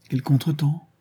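ordinary delivery, nothing marked

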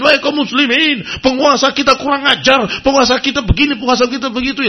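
high, loud crowd-stirring preacher; mocking rabble-rouser. Preacher mocks agitators stirring the Muslims, loud and high. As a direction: whip up the crowd against the rulers. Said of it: "ngomongnya kepada kaum muslimin".

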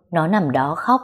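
plain reading voice

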